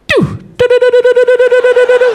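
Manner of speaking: high chirp into rapid loud stutter; machine-gun string of high electronic beeps; imitated crossing signal. Comic mimics the street-crossing signal he hears, high rapid beeping. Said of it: "this is what I hear".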